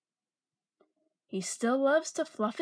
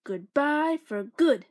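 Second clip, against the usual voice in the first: louder and slow, each word final; firm goodbye; 6 dB louder, slower than usual. Acted. Mister Duck sending the animals off for good, loud and slow. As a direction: shut the door on them. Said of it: "said Mister Duck".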